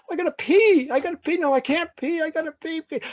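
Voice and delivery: very high, frantic repetition; escalating panic. Acted. Frightened boy needing the toilet, frantic panic. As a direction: blurt it out in rising desperation.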